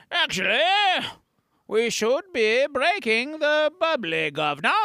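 high, hammed-up English accent; badly faked British "governor" voice; terrible English accent. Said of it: "That's a terrible English accent".

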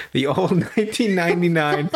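real laugh breaking into "nineteen ninety-nine"; amused laughing. Real, not acted. Laughs at how old it is.

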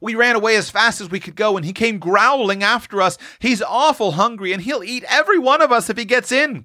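put-on high-pitched scared boy's voice; urgent high child's warning; frightened boy. A narrator's reading. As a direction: tumble through it in childish alarm. Sol continues his frightened tale.

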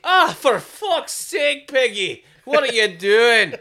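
put-on Scottish accent. Coworker imitating a Scot swearing at Piggy, Scottish accent. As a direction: bellow it like an exasperated Scot. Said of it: "those come out quite a bit more".

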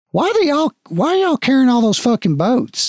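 can't make sense of the boats; puzzled guard. Acted. Guard eyeing the party's boats, high and baffled. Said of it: "He's like".

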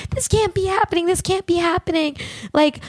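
high, pleading mock sobs; mock crying. Sherry caught by the cops, mock tearful denial. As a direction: imitate her fake tearful denial. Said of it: "She starts crying".

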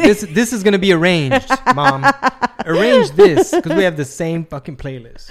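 loud mock phone call. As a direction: shout it to mom in jest. Friend mock-voices driver calling mom.

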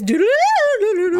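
high sung ringing tone; copying the old man's ringtone, sing-song high; ringtone imitation. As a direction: mimic a loud phone ring. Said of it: "it's like this".

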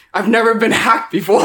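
high, loud retold panic; freaking out. Retelling getting hacked to friends, mock freaked-out. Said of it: "I'm freaking out".

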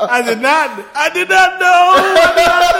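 high, loud laughing disbelief; cracking up, can barely get it out. Real, not acted. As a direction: laugh through the words in disbelief. Cracking up at a joke.

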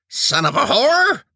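high pained yell; shouted curse. Acted. Preacher sitting on his wound, high pained shout. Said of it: "he shouted".

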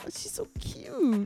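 soft, high whisper. Real, not acted. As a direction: breathe it out in a whisper. Gushing over Janie as a poodle.

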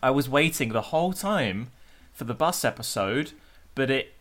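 normal talking voice

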